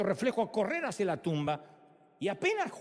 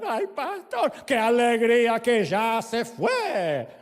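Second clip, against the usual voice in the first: relieved widow; 3 semitones higher, 7 dB louder, much slower than usual. Retold or imitated. Widow gushes to the pastor about her dead husband, loud and slow. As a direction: gush like a widow glad he's gone. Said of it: "Y venía la viuda".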